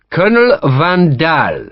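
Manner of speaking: Van Dahl with weight on Dahl, slow, French officer; stress on second syllable. Acted. French officer naming his colonel, stress on DAHL. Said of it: "putting the stress on the name's second syllable".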